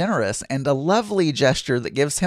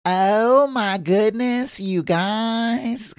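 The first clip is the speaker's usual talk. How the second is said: slow, higher put-on voice, stretched out; mocking impression of the host; 7 semitones higher, much slower than usual. [name] mimicking the host for the listeners, slow and high. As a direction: ham up a gushing host's catchphrase. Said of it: "that's what I sound like".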